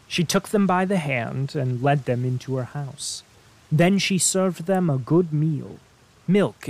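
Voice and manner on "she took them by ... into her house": usual speaking voice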